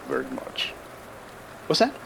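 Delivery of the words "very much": low, soft mumble under the breath; muttered aside. Acted. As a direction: slip it in almost unheard. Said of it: "We little people with our quiet voices".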